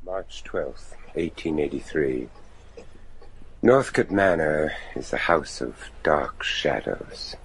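low, measured journal reading; diary narration. A narrator's reading. Deacon recording the night in his diary, low measured narration. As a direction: read the entry in a grave low voice.

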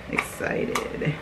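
soft, whispered excitement; hushed excitement. Real, not acted. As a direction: whisper it, too thrilled for full voice.

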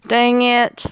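high, drawn-out put-on whine; mock frustration. Player whose character just died, mock frustration.

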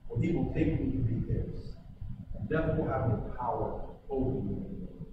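ordinary delivery

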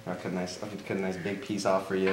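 normal talking voice

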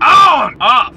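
high loud punchy on, then off; emphatic shouts. Real, not acted. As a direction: snap each word out like a switch. Binary on off shout.

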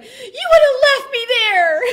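high, very loud mock wail; imitated aggrieved mom shouting; indignant accusation. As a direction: accuse them at full volume, outraged. Retelling mom's indignant accusation.

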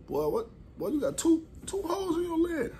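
high, cracking alarmed cry of a friend. Retold or imitated. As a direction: panic for him, voice breaking. Friend alarmed at his wounds.

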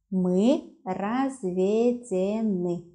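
мы разведены very slowly, each syllable separate; slow, measured dictation of разведены. Shown as a demonstration. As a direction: break разведены into drawn-out syllables for a learner. Teacher repeats word syllable by syllable.

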